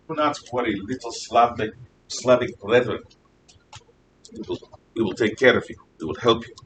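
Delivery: a lightly put-on Russian accent, soft and fatherly; hammed-up Russian accent, barely there; bad Russian accent. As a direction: play Russia soothing a little brother in a weak Russian accent. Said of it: "Yes, my Russian accent stinks".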